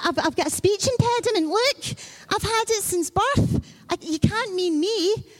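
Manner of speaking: lightly voiced anxious Moses; pleading excuse. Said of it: "he even produced his doctor's certificate".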